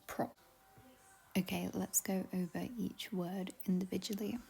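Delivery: whispered word-list intro; soft unvoiced whisper to start the list. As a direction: breathe it out softly. Whispered intro over music.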